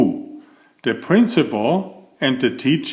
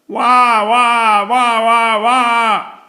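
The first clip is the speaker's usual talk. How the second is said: put-on high loud baby wail; louder baby crying; 10 semitones higher, 5 dB louder, much slower than usual. A narrator's reading. Baby crying even louder in class. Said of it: "The baby cried even louder".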